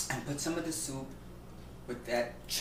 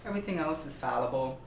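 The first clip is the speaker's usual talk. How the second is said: normal talking voice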